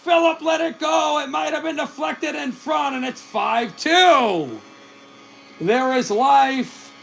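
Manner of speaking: very high-pitched, exultant shouting; excited goal call. Real, not acted. Commentator announces Philip Hronek's goal, excited goal call. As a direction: call it out high, stretching the key words.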